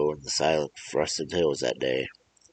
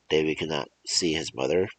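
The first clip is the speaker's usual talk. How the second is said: usual speaking voice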